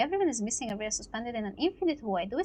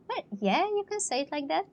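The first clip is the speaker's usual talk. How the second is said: ordinary delivery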